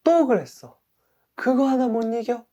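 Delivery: acted scolding that jumps up high; self-scolding. Person who broke a resolution berates themselves, scolding. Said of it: "나 자신을 엄청 꾸짖는단 말이에요".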